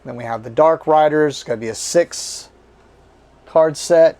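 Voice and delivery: normal talking voice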